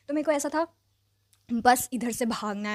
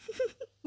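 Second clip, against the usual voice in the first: quick giggle breaking out; a little amused laugh at the memory; real giggle; short high laugh; 13 semitones higher, 8 dB quieter than usual. Real, not acted. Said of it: "मुझे हंसी आ रही है".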